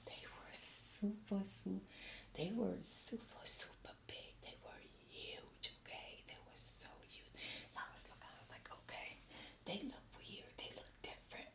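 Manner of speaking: breathy whispered gossip, slowed down; hushed whisper. Real, not acted. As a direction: lean in and whisper it like a secret. Excited whispering about huge tamales.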